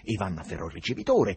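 usual speaking voice

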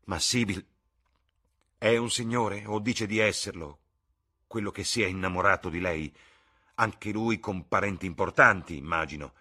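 loud, bitter mocking echo; bitter anger. Son mocking his mother's words, loud and bitter. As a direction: throw her words back with scorn.

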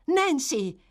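high, loud cry of her name; alarmed shout. A narrator's reading. Boy cries out as Nancy seems to choke, alarmed shout. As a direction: call out to her in fright. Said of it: "gridò Oliver".

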